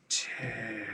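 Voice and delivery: soft, faraway ghost murmuring his name; ghostly murmur. Acted. Game master voicing the dazed ghost Terrence, soft murmur. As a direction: let the name drift out, lost.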